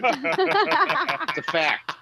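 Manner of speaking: slow, high, stretched-out delivery; milk the line out slowly; drawn-out fact. Acted. Comic stretching the punchline out slowly and high.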